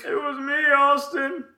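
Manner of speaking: high-pitched hammed-up confession; theatrical put-on gloating voice; mock villain reveal.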